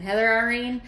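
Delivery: a mother calling her daughter's full name; mom's scolding tone. Retold or imitated. Daughter imitating her mother's stern call of her name. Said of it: "She gets that tone in her voice".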